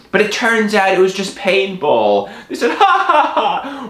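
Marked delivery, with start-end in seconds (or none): 2.7-3.9 high spelt-out ha-ha-ha; mock laugh. Hoodlums revealing a paintball prank, mock laughter. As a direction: laugh theatrically, pitched high.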